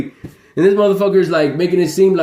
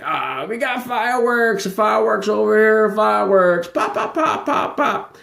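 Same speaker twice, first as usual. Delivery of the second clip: higher, silly put-on voice with popping sounds; mocking imitation; 4 semitones higher than usual. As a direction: ham up their bragging. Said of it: "Y'all be like".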